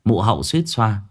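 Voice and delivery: usual speaking voice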